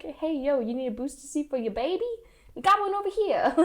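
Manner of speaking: high, fast New York ghost voice; brash Brooklyn hustler pitch; New York accent. Retold or imitated. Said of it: "That's my New York accent".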